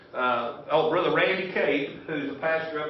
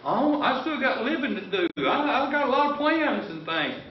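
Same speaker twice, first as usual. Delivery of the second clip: mock-breezy young believer, pitched much higher with a laugh; carefree young person; 10 semitones higher than usual. Preacher imitating younger folks who think they have plenty of time, high and laughing. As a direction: brush it off lightly like youth with time to spare. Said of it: "You may think to yourself".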